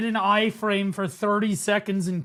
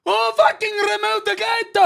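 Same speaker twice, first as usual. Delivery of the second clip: high, loud outraged yell; mock angry callout; 12 semitones higher, 3 dB louder than usual. Streamer echoes the furious caller in the clip, yelling. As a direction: bark it like a furious raid leader.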